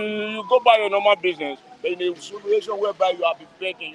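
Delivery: ordinary talk, nothing marked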